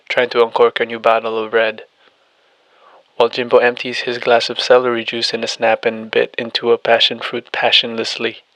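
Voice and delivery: ordinary delivery, nothing marked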